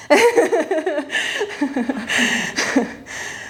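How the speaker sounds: long, rippling giggle tailing off; hearty laugh. Real, not acted. Coach amused at pulsing with the class, hearty laugh. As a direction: break into an extended, breathy laugh.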